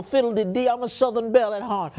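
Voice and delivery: hammed-up Southern belle accent over the bidding. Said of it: "Why am I talking a Southern accent?".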